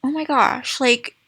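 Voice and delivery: ordinary delivery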